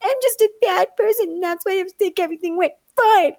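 put-on high, rushed little-boy lament; sulking child. Nephew sulks about his punishment, high and pouty. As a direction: pout it out like a wronged kid. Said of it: "his little face was like".

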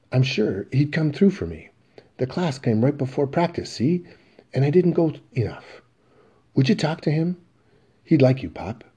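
coaxing his dad to go talk; pleading son. Acted. Biff pleads to father.

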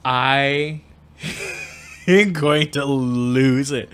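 laughing. Real, not acted. Breaking into laughter.

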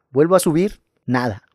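plain reading voice

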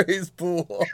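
high, laugh-filled repeat; helpless amusement. Real, not acted. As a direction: crack up while repeating the joke. Cracking up at the joke.

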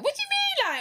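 put-on high-pitched London-girl comeback; London attitude. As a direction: re-enact an indignant London retort. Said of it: "London behaviour came out".

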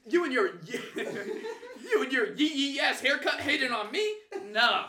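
high, loud heckling comeback; mock indignant yell. Heckled fan firing back at rival, mock outraged yelling. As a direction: throw the insult back loudly.

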